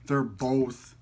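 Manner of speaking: ordinary talk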